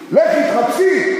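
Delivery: לכי said high and slow; emphatic. Shown as a demonstration. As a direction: stretch out לכי with a lift. Re-reading with emphasis. (לכי מוטעם, גבוה ואיטי)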